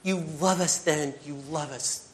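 earnest spoken prayer to God. Real, not acted. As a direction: pray aloud, sincere and pleading. Prayer closing the sermon.

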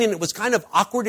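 ordinary talk, nothing marked

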